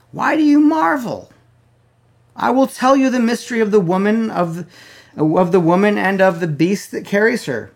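high, loud preached reading of the angel; fervent scripture reading. Acted. Preacher reading the angel's words to his church, high and loud.

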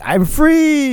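high, gleeful cry of freedom; relieved shout. Retold or imitated. Teacher telling a friend about his week, gleeful high cry. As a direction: squeal it like a kid let out of school. Relief after finishing work.

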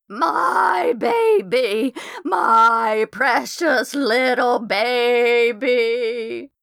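high, slow, crying lament; ghost wailing. A narrator's reading. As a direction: wail for the lost baby. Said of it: "the voice wailed".

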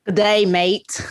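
put-on slow, drawn-out Australian accent. Asked by the host to say it in an Australian accent. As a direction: greet like an Aussie, Australian accent. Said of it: "in an Australian accent".

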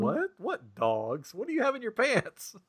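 high-pitched mock confusion, jumping up on each What; baffled mock reply. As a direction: play it as stunned disbelief at a strange remark. Retelling a baffled reaction.

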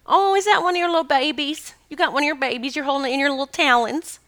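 high-pitched doting coo; cooing baby talk. Retold or imitated. Woman to the eagle with something in its talons, cooing. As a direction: coo up high at an adored creature.

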